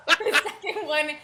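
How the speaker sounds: giggling through the words; laughing. Real, not acted. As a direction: laugh while narrating. GM laughing mid-narration.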